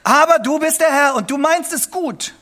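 high and loud, a proclaiming prayer; prayer rising into a loud, high declaration; ardent cry of faith. Pretend. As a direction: cry it out with conviction. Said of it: "und sagen Sie".

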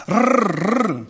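rolled rrr like a starter that won't catch; imitated engine sputter. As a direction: mimic a dead motor cranking. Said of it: "berichtet das so schön".

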